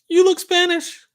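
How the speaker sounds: very high, loud squeal; mock repeat. Streamer echoing a viewer's comment, mock squeal.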